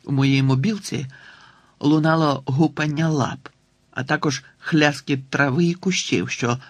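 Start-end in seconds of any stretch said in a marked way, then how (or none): none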